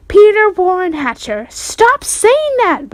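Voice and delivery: high, slow, loud scolding; mother scolds son by full name; hollered. A narrator's reading. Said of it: "Mom hollered".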